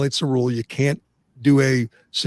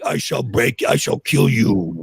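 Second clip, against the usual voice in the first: Ivan Drago again; 2 semitones lower than usual. Retold or imitated. Host doing his Drago bit for co-hosts, slow hammed-up Russian threat. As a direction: drag out the menace in a hammed-up Russian accent. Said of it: "You can imitate Drago".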